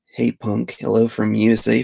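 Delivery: ordinary talk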